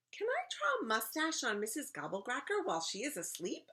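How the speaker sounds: put-on high-pitched child character voice; small, high voice pleading for permission; little girl asking. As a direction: ask like a hopeful little kid. Little imaginary friend asks Mary's question.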